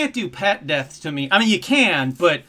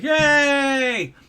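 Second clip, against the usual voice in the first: high, bright cheer; 7 semitones higher than usual. Pretend. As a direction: fake applause cheer. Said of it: "I could be like".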